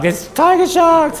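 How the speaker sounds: imitating his friend's frightened cry; panicked quote. Retold friend panicking near sharks.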